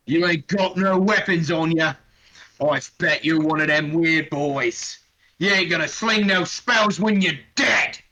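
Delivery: an orc sneering at an unarmed caster; mocking orc. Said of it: "he looks at you and goes".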